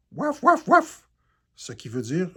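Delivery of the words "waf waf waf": high, loud puppy yaps; girl yapping; yapping like a dog. A narrator's reading. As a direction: bark it out like a small dog. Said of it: "La fille jappe".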